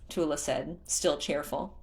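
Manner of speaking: usual speaking voice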